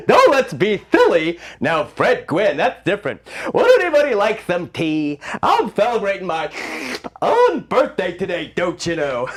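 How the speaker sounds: dotty giggling tea-party host; Mad Hatter. Acted. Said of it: "Can you do a Mad Hatter voice?".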